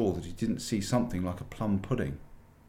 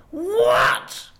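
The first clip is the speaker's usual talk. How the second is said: much higher, very loud outraged cry; roaring witch; 11 dB louder than usual. A narrator's reading. Witch queen roars at the fox in outrage. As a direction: explode with the word. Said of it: "roared the witch".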